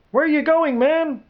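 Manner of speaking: high-pitched bewildered call; calling after someone. A narrator's reading. Soldier calls after departing general.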